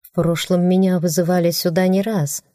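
plain reading voice

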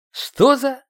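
high, loud cry of surprise; startled exclamation. A narrator's reading. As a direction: blurt it out, pitch shooting up. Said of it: "воскликнул я". (удивлённый возглас)

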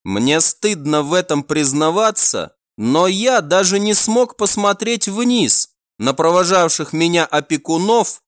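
usual speaking voice

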